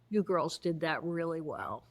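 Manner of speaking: brisk manager. Retold or imitated. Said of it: "Helen, character one, comes online and says".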